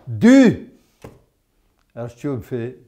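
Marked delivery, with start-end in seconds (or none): 0.1-0.7 Du. barked high and loud on its own; emphatic correction. Shown as a demonstration. Teacher drilling the pupil, loud high Du. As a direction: punch Du. hard and high to drive it home. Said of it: "Mordu, répète".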